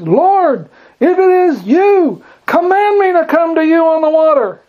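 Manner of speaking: high-pitched shout across the water; hollering back. Acted. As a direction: holler it like you must be heard over a storm. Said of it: "in the same tone of voice".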